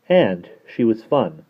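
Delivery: ordinary delivery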